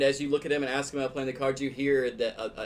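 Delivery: ordinary delivery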